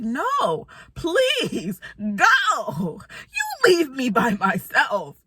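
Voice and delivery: drawn-out, hammed-up whiny plea; mock pleading. Telling him to go dance without her, in exaggerated mock-tearful pleading. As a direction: beg like you're nearly crying, stretching the words.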